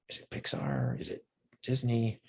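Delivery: very soft, near-whispered guessing; hushed aside. Retold or imitated. Guessing the famous company mid-story, in a hushed aside. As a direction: murmur it like a private guess.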